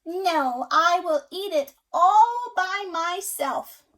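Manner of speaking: loud, slow triumphant hen; defiant hen. A narrator's reading. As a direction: lay down the law slowly. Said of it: "She said".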